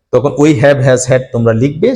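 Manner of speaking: ordinary talk, nothing marked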